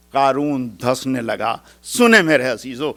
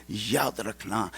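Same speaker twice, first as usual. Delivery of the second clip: ordinary talk, nothing marked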